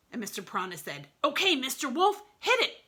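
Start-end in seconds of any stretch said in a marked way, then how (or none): 1.2-2.8 high, loud cue; calling out. A narrator's reading. Mister Piranha cues the getaway driver, high loud call. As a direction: shout the signal to go.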